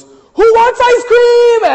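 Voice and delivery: put-on high, slow excited yell to the kids; hyped-up shout. Uncle riling up the kids before bed, loud excited shout. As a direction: belt it out like the fun uncle barging in. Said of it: "Uncle Jimmy comes home and he goes".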